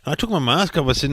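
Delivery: ordinary talk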